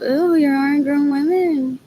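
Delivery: high, loud, slow mocking sing-song; mocking exclaim. Retelling what she said aloud to relatives, mocking loud jeer. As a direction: jeer at them for acting childish. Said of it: "So when I said out loud".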